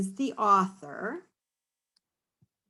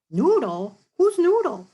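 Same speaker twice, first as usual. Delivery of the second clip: mock surprise; 4 semitones higher, 6 dB louder than usual. Playful mock surprise at dog.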